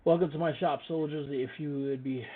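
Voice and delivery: high, loud character voice of a trader; foreign shopkeeper welcome. Acted. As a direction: hail the soldiers as an eager merchant. Voices the shopkeeper greeting soldiers.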